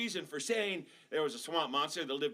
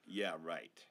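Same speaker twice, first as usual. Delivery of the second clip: sarcastic mom; 11 semitones lower, 6 dB quieter than usual. Acted. Mom rolling eyes.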